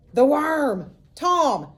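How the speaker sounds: put-on high, loud call of a kid with news; excited shout. A narrator's reading. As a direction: shout the news across the yard. Pete runs in shouting.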